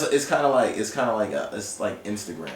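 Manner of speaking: ordinary talk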